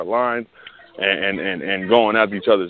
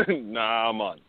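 softer mock Jamaican accent; 9 dB quieter, much slower than usual. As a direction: repeat it quietly, imitating a Jamaican. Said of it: "like, the Jamaican voice".

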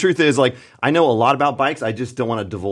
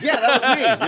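laughing; 12 semitones higher than usual. Retold or imitated. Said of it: "I'm like".

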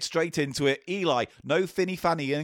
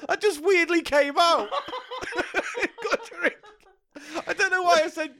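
laughing; 13 semitones higher than usual. Real, not acted. Laughing at own slip.

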